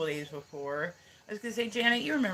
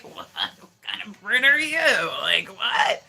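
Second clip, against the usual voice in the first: higher, louder incredulous outburst; hammed-up shock that a Brit hates tea; mock disbelief; 4 semitones higher, 7 dB louder than usual. Retelling her disbelieving reply to the British friend, mock shocked. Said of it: "I'm like".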